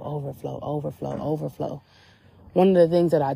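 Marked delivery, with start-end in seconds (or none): 0.0-1.8 each overflow softer, like a fading echo; soft echoed word. Retold or imitated. God repeating a word to her at the beach, hushed echo. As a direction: let overflow fade away, hushed. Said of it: "he just kept saying the word overflow".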